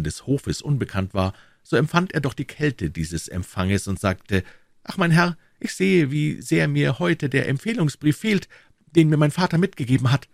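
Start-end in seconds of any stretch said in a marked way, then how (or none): 4.8-10.3 put-on high-pitched, impetuous young hero lamenting his loss; eager young D'Artagnan, dismayed. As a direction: play the youth much higher, pleading his case. Voices young D'Artagnan in dialogue. (junger, bestürzter D'Artagnan mit hoher Stimme)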